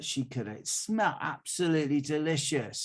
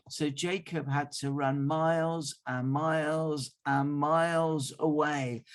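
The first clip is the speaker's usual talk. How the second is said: ordinary delivery, nothing marked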